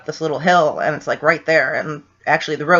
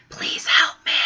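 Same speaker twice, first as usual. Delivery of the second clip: whispered plea from the recording; ghostly whisper; 4 dB quieter than usual. Retold or imitated. A spirit's voice on the recorder, whispered plea. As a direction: breathe it like a faint spirit voice. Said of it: "you can hear a voice say".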